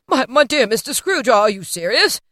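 loud, stammering disbelief; stunned gentleman. A narrator's reading. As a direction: sputter an astonished question.

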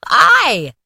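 loud, high, indignant spirit; cried out. Acted. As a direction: burst out in shocked protest. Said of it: "cried the spirit".

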